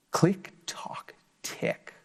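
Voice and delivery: breathy whispered ticking. Acted. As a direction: whisper it like a secret. Sneaky chick at night.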